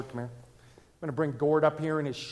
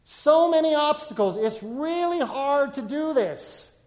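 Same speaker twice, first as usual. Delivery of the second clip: higher, louder voice of someone overwhelmed; frustrated believer; 8 semitones higher, 5 dB louder than usual. Retold or imitated. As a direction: voice a struggling person, strained. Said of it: "There's just things".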